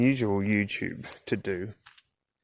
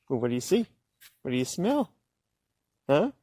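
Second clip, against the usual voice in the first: ordinary delivery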